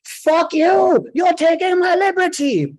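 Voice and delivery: high, furious shouted outburst; full-force scream. Retold or imitated. Shady guy to border agents in a crowded airport, screaming. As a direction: scream it at the top of your lungs. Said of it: "screams at the top of his lungs".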